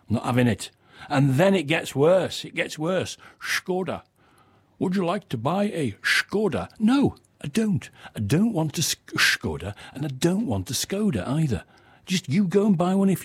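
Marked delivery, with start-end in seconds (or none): 3.4-6.7 Skoda said "SHKOR-da", high; mock Skoda. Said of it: "ask for a Skoda, not a Skoda". 9.1-9.7 Skoda said "SHKOR-da", low; mock Skoda. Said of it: "ask for a Skoda, not a Skoda".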